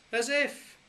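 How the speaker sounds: very high, cheeky as if; overplayed innocent protest; hammed-up kids; mock innocence. A narrator's reading. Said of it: "we chorused".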